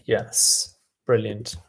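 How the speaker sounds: usual speaking voice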